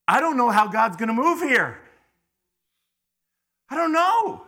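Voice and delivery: loud, rising cry of uncertainty; preaching shout. Real, not acted. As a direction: call it out to the whole room.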